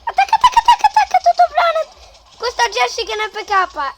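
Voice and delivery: high, loud frantic yelling; breathless italian goal commentary. Real, not acted. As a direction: scream it like a goal call. Shouting at game action.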